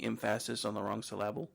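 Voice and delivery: "emphasis" and "syllable" stressed on the second syllable; misplaced stress. Shown as a demonstration. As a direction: say em-PHAS-is and syl-LAB-le for "emphasis" and "syllable". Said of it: "they were mispronouncing words".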